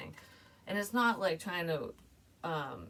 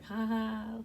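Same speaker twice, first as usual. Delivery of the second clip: higher-pitched gloating gossip voice; mocking laughter; 5 semitones higher, slower than usual. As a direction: imitate people laughing behind someone's back. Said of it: "people could have been laughing about it".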